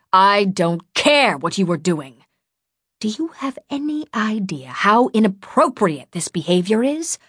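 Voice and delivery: hard, emphatic telling-off, rising into an outraged question; angry scolding. A narrator's reading. Furious woman cuts off the boy's excuse, angry scolding. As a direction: cut them off and scold. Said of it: "She had stopped yelling".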